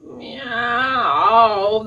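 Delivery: long, drawn-out mournful meow; heartbreaking cat meow. A narrator's reading. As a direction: draw the meow out into a pitiful wail. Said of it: "would break your heart in two".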